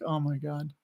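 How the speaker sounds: softly stunned, mostly to oneself; retold dismay. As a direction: say it under the voice, dropping away. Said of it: "it's just like".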